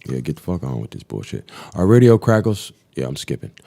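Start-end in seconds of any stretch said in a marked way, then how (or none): none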